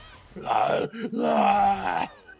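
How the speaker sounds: loud, high mock-monster roar; wordless bellowing shriek, acted; monster scream. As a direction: imitate an animatronic screaming over someone. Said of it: "And it's just".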